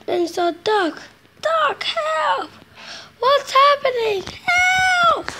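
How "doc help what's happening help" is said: high, shouting cries for help; panicked cries. Acted. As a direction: yell for help as you lose control. Runaway engine calls for help.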